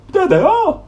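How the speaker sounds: very high, loud cry of discovery; excited shout. Acted. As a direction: shout out pointing at them. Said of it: "shouted Slobby Dog".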